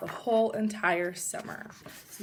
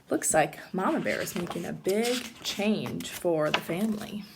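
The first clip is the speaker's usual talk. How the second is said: ordinary delivery, nothing marked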